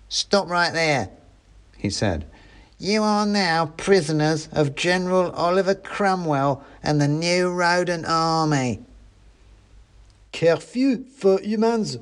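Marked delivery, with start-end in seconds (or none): 0.1-1.1 high, slow mouse soldier's order; mouse sentry. A narrator's reading. Mouse sentry challenges travellers. 2.8-8.8 high-voiced, self-important rodent soldier; mouse soldier. A narrator's reading. Mouse soldier announces capture. 10.3-12.0 high, slow, different mouse voice; second mouse revolutionary. A narrator's reading. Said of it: "said another voice".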